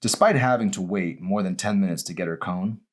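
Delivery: ordinary delivery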